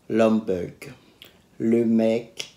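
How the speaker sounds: ordinary talk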